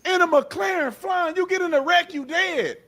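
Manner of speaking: high, shouted scolding; high frustrated shouting rant. Real, not acted. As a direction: yell at them to stop being silly. Ranting, shouting.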